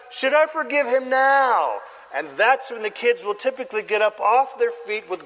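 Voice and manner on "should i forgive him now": high, slow appeal to the crowd; stage king. Acted. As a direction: put it to the audience theatrically. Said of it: "the king turns to the crowd".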